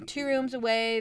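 ordinary talk, nothing marked

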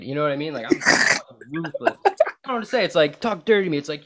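normal talking voice

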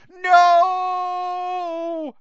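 high, loud, desperate shout; yelling. A narrator's reading. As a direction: scream it in anguish.

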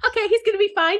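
high, chirpy imitated reassurance; mock carefree. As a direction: chirp it like nothing could go wrong. Acting out misplaced confidence while reading.

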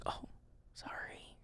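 hushed whisper so mom won't hear; retold whisper. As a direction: whisper the apology under your breath. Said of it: "we're literally, like, whispering".

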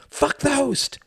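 very high, explosive retort; furious outburst. A narrator's reading. Manic boy rejects Tom's excuse, furious outburst. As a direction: burst out in frantic defiance.

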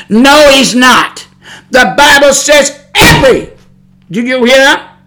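loud, forceful pulpit delivery; fiery preaching. Real, not acted. Preacher rebuking lying preachers with God's word, fiery preaching. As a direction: preach it hard, stretching key words.

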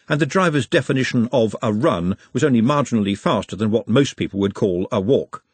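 usual speaking voice

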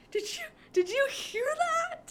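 very high, loud mock-startled question; imitating freaked-out reaction. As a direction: squeal it like spooked onlookers. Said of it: "kind of freaked him out".

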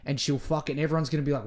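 ordinary talk

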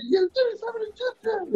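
high, strained pitchman growl, held back in volume; hammed-up ad catchphrase impression; Slim Jim guy. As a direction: imitate a hyped snack-ad wrestler, softly. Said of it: "The guy that snapped into the Slim Jim".

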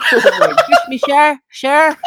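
high-pitched put-on Filipino accent; giggly, exaggerated Filipino-accented English. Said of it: "Filipino accent".

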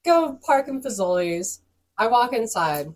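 normal talking voice

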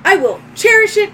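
high, loud robot solemnity; robotic droid. Acted. Droid thanking owner for a gift, high robotic voice. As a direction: pledge it formally as a machine. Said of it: "And it goes".

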